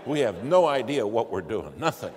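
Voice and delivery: loud, helpless admission; exasperated outburst. Retold or imitated. Passenger answering the cargo pilot, loud exasperated confession. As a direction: blurt it out loudly in exasperation. Exasperated reply to radio caller.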